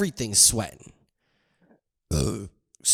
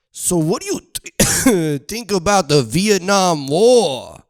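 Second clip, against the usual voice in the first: put-on slow, raised old-man voice; mock old man; 5 semitones higher, 4 dB louder than usual. Mocking the old man's sudden war question, slow.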